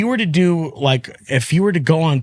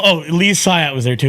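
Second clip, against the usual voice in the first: ordinary talk, nothing marked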